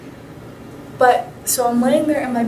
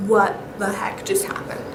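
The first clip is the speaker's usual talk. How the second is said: confused whisper; 5 dB quieter than usual. Retold or imitated. Shadow vanished, confused self-quote.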